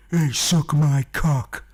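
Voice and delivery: slow, loud mock false Jesus voice; a leering fake Jesus at the door, drawn out.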